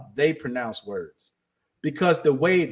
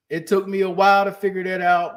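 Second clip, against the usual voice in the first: usual speaking voice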